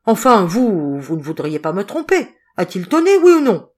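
loud, harsh interrogation rising to a shout; brutal demand. A narrator's reading. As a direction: press them, then explode on the final question. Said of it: "leur demander avec brutalité".

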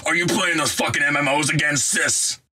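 high, fast, accusing voice; pretend background man. Gamer faking a man who catches his sister playing, accusing. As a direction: burst in on your sister, annoyed. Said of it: "pretend to be a man in the background".

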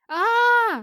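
long high scream of terror; terrified scream. A narrator's reading. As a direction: scream loud in sheer panic. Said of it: "первым заорал Ернир".

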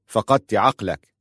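slow, firm verdict; firm and deliberate, no room for argument; decisive. A narrator's reading. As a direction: state it with measured finality. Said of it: "قال في حسم".

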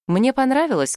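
usual speaking voice

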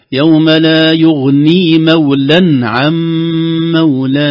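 loud, high, slow chant; tajweed recitation. Shown as a demonstration. Teacher repeating the verse for the caller, loud slow tajweed. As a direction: recite loudly and slowly with held nasal sounds.

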